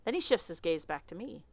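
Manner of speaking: normal talking voice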